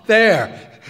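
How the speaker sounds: high, loud emotional burst; puffing with emotion. A narrator's reading. Bandleader thrusting his trumpet at the boy, loud and emotional. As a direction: blurt it out, overcome. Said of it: "puffing with emotion".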